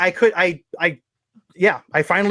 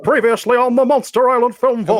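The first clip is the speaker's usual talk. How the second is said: announcer guy; 5 semitones higher than usual. Pretend. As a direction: boom it out like a TV recap announcer. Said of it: "I don't get to use announcer guy that much".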